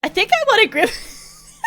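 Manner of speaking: high voice breaking up with laughter; real laughing. Real, not acted. Streamer recalling waking up craving a shake, laughing. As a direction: laugh helplessly through the retelling. Laughing at own absurd dream.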